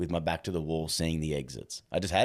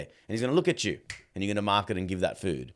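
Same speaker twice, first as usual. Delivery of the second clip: ordinary delivery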